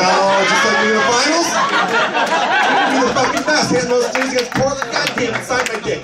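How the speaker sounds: a starstruck fan losing it; to Jordan, hammed-up starstruck gushing. Fawning over Jordan.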